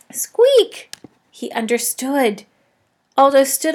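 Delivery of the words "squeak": one very high loud squeak; eager squeak; hamster squeak. A narrator's reading. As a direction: pipe up like a hamster. Hamster answers Aldo.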